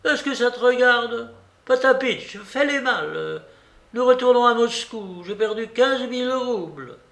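high, loud scolding commands; irate babouchka. A narrator's reading. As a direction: order the servants about in a high old-lady voice. Babouchka snaps orders at her servants. (babouchka irritée et autoritaire)

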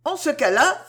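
high exclamation, drawing back; exclaiming. A narrator's reading. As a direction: cry out as you pull away. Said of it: "s'écria Cécile en se retirant".

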